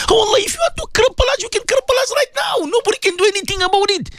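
very high, fast, urgent pleading; desperate outcry. Real, not acted. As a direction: pour it out rapidly at a high pitch. Desperate submission at prayer's climax.

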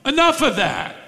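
high, indignant stop it; indignant protest. Shown as a demonstration. Demon protesting Jesus' preaching, high and indignant. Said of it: "We could translate it".

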